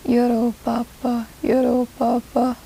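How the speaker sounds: strange sing-song chant of a foreign phrase; a masked stranger mumbling a foreign greeting; odd foreign voice. Retold or imitated. Masked stranger greets the narrator, in a strange foreign voice. Man in mask speaking strangely.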